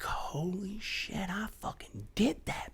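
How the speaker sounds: hushed, slow whisper of disbelief; awed whisper. Retold or imitated. Hiker at the end of a hike, whispering in awe. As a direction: breathe it out in amazement.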